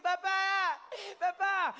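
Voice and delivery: high, stretched wail for Papa; terrified French child. Retold or imitated. Frozen child calling his fleeing dad, mock terrified wail. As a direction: mimic a scared little French boy. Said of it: "little Jean-Pierre is planted to the spot".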